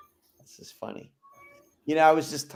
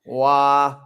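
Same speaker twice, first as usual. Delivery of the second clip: imitating Midwestern accent; 10 dB louder than usual. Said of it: "that Midwestern accent really coming out".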